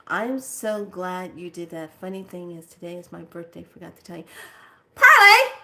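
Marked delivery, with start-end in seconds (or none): 4.9-5.5 very high, loud call of the name. Real, not acted. Calling Parley across the room, loud and high. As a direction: call the dog sharply.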